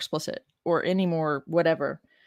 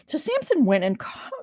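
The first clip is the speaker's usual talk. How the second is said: upset; 6 semitones higher, 3 dB quieter, slower than usual. Real, not acted. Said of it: "it just makes me so upset".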